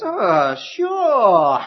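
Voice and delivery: high, loud mock-obliging "sure"; a falsely cheery father handing over harm; sarcastic father. Father handing his son a scorpion, mock-cheery. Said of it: "And the father says".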